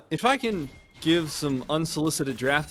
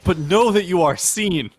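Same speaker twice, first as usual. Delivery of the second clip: dramatic declaration; 4 semitones higher, 6 dB louder than usual. Acted. Mock dramatic reply.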